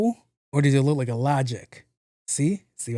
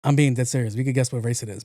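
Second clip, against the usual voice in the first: usual speaking voice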